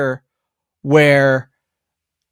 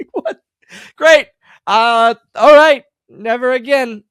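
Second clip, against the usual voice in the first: high, loud, mock-stunned "great", then a resigned "never again"; stunned disbelief; 8 semitones higher, 5 dB louder than usual. Wrestler reliving his reaction to "not good", mock-stunned then resigned. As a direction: act out the crushed shock of hearing it went badly. Retold disappointed reaction after pre-tape.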